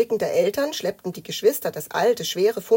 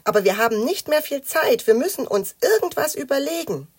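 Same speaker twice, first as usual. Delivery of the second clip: put-on higher, mildly reproachful child; slightly miffed; 7 semitones higher, 6 dB louder than usual. A narrator's reading. Matz urges the idle players to think, slightly miffed. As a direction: press them, a little huffy. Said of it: "ein wenig eingeschnappt".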